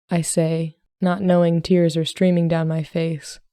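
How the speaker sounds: usual speaking voice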